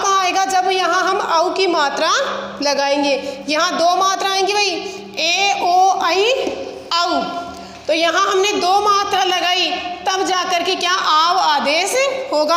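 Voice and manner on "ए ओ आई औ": slow spelling out. Shown as a demonstration. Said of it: "teacher spells out vowel signs".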